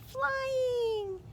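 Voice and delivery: soaring, drawn-out high word; high-flying cry. Acted. Reader saying the duck is flying, in a high drawn-out cry.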